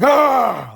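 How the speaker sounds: high, loud inhuman scream; imitated monster scream. Dreamer screaming back at a scary principal, inhuman shriek. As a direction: shriek like a morphing creature. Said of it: "So I screamed back".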